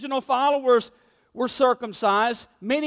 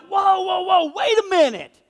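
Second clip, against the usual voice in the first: raised, exasperated protest; half-shouted "whoa, wait"; mock angry objection; frustrated outburst; 5 semitones higher than usual. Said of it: "extreme frustration and anger".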